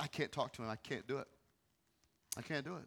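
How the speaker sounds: very soft, broken-down refusal; soft, defeated murmur; hushed despair. Retold or imitated. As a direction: say it like you have nothing left. Retelling his despairing refusal.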